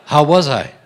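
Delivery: mock-eager pitch up for approval; eager self-quote. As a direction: play it as an eager actor fishing for praise. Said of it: "I went".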